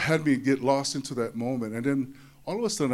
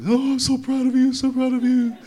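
much higher, louder gushing mother; proud mom; 11 semitones higher, 8 dB louder than usual. Retold or imitated. Mom praises her son's trophy, high and gushing. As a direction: gush like a delighted mama. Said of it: "And she".

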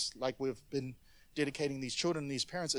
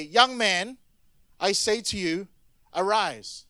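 commanding call; 3 semitones higher, 6 dB louder, slower than usual. Acted. Jesus commands dead man rise.

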